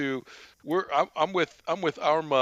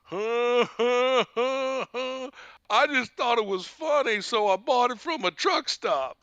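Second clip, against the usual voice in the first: raised oafish voice with huh-huh chuckle; Uncle Mike; 5 semitones higher than usual. Retold or imitated. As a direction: chuckle dopily round the words. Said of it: "He goes".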